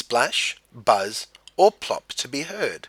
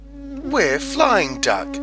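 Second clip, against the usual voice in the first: high, louder surprised reply; surprised dragonflies; 5 dB louder, much slower than usual. A narrator's reading. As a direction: squeak it out, amazed. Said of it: "said the dragonflies in surprise".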